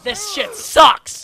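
slow, loud, furious outburst; dragged-out angry yell at the game. Real, not acted. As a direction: shout it out in real rage. Raging after dying again.

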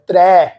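ਤਰੈ ਭਵਨ as "trai", wide ai vowel; mistaken open vowel. Shown as a demonstration. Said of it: "ਇਹ ਪਾਠ ਗਲਤ ਆ". (ਤਰੈ ਨੂੰ ਦੁਲਾਵਾਂ ਨਾਲ ਖੋਲ੍ਹ ਕੇ)

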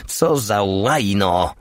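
mildly angry, drawn-out curse. A narrator's reading. Cursing after dropping an egg, mildly angry. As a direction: curse slowly with a touch of fury. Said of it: "wściekła się i kopnęła skorupkę".

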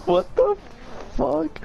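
squeaky stunned question; high shocked yelp. Real, not acted. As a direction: shriek it in disbelief. Shocked after weird laugh.

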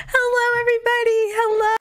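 hammed-up creepy greeting, high and fast; lightly voiced villain calling out to everyone; villain impression. Impressions for chat, a high, fast creepy greeting. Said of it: "pretty good impression".

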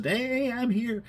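preacher voicing a bird landing on a branch; playful bird voice. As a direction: chirp it like a happy bird.

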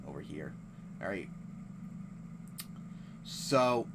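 ordinary talk

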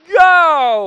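long, high, loud yell; sudden full-voiced cheer; hopeful shout. Real, not acted. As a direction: holler it as the ball flies. Cheers a swung ball.

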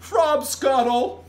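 giant proclaiming loudly and slowly; big, drawn-out high proclamation; grand announcement. A narrator's reading. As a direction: announce it with relish, pitched high. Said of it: "announced the B F G".

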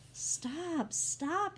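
high, desperate cry; mild scream. A narrator's reading. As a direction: cry out in alarm, rising on the repeat. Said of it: "Elihu screamed".

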